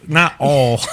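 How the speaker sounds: "all" as the drawled way of saying oil; mimicked "all". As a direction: echo the wrong "oil" as a flattened "all". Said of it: "It's oil".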